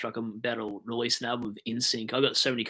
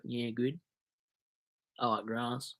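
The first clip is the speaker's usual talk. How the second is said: man of few words; 8 dB quieter, slower than usual. Retold or imitated. Footballer answering small talk about footy, uncommunicative.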